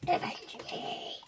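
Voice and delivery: breathy hammed-up last words; dying whisper.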